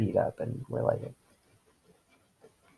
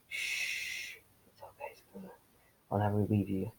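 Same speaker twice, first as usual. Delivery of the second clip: very soft, hushed reassurance; whispery comforting boyfriend roleplay; boyfriend roleplay ASMR; 2 semitones lower, 6 dB quieter than usual. Acted. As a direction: shush and soothe like ASMR. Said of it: "I just sounded like the boyfriend roleplay A S M R".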